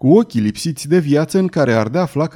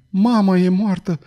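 moaning; 6 semitones higher than usual. A narrator's reading. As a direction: wail it in disbelief and grief.

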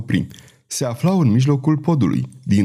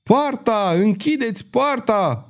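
high, urgent captain's cry; yelled command; 11 semitones higher, slower than usual. A narrator's reading. Captain ordering the gate shut, yelling. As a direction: call out high, stretching the key word. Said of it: "urlă capitanul Larchon".

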